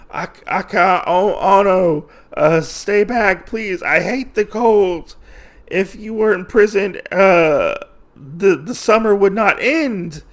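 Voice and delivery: high-pitched whimpering imitation; mock frightened pleading. Wolf cowering before the autumn spirit he caged, mock frightened pleading.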